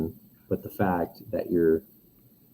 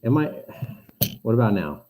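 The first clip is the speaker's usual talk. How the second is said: muffled, far-off mic sound; like talking through a can. Real, not acted.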